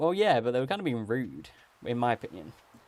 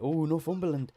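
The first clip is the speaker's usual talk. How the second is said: Northumberland said with a light Geordie lilt; a quick Geordie-flavoured Northumberland; Geordie accent; 5 semitones higher than usual. Shown as a demonstration. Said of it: "whiplash from your accent".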